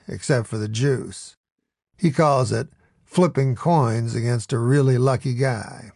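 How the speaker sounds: ordinary delivery, nothing marked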